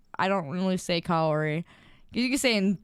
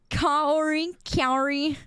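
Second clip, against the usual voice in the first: imitated callers; 8 semitones higher, much slower than usual. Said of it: "People like".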